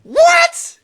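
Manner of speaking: loud, very high mock shriek; hammed-up squeal of disbelief; freaking out. Son freaking out at his mom, shrieked disbelief. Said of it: "So I'm freaking out".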